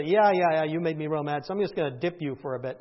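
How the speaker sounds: hint of a low, grumbling punisher; mock grudging God. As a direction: lightly act out petty annoyance, dropping the voice. Mock imagined God punishing briefly.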